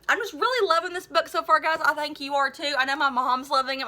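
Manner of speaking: plain reading voice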